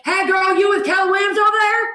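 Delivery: high hollered Texas greeting; loud imitation of a Texan woman calling out; Myra Oliver. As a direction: yell it across the room. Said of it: "it was across from, she's like".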